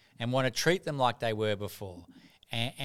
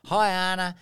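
breezy mum; 5 semitones higher, 4 dB louder than usual. Retold or imitated. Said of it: "Mum says".

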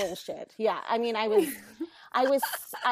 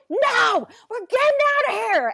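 loud, high-pitched outburst; angry parent; 11 semitones higher, 9 dB louder than usual. Retold or imitated. Mother hauling her kids out, angry shout. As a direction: snap at the kids, fast and loud. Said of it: "my anger seems so bizarre".

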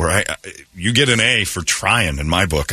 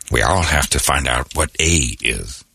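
low, slow presidential pronouncement; deep, deliberate voice of a solemn leader; Morgan Freeman; 8 semitones lower than usual. Acted. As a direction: address the nation slowly, down low. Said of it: "Morgan Freeman was president and he said".

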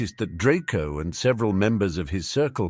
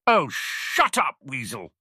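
slow, higher sneering bark; barked insult; 4 semitones higher than usual. Acted. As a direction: bark it at her with contempt. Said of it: "barked Draco".

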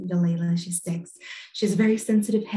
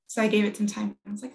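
ordinary talk

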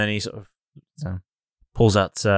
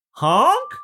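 high, rising quizzical honk; questioning goose honk. Acted.

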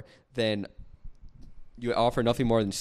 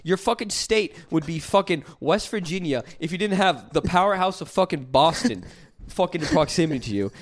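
ordinary talk, nothing marked

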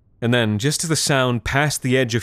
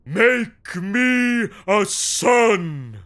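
much higher, very slow proclamation; booming command; 12 semitones higher, much slower than usual. Acted. Big man commands the machine, booming. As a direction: boom it out like a ritual order. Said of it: "In a booming voice, he commanded".

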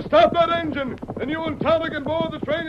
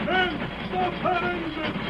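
slow, urgent shout over the train noise; shouted order; 5 dB quieter than usual. Acted. Masked rider galloping beside the train, shouting for the engine to stop. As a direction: yell the order at the crew on the train.

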